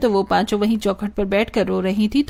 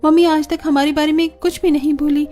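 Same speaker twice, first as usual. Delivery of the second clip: higher-pitched voice of an earnest daughter; 6 semitones higher than usual. Acted. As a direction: play the daughter higher, pleading her point. (बेटी की ऊँची, भावुक आवाज़)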